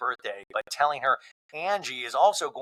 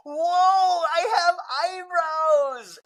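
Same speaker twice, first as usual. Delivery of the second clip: high squealing drawn-out whoa; thrilled at her reflection in the mirror; slow, high, delighted exclamation; amazed teen; 4 dB louder, much slower than usual. Retold or imitated. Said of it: "and Bobby's like".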